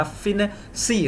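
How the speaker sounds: फिनेसी said slowly, drawn out in parts; slow word demonstration. Shown as a demonstration. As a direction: stretch फिनेसी out, softly, piece by piece. शिक्षक शब्द तोड़कर दोहराते हैं. (फिनेसी को धीरे-धीरे खींचकर बोलना)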